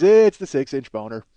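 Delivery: a light imitation of a dry late-night host; David Letterman delivery. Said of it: "He goes".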